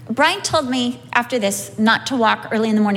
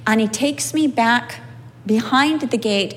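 ordinary talk, nothing marked

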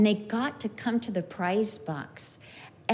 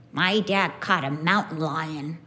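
put-on child boasting; 6 semitones lower than usual. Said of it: "he said".

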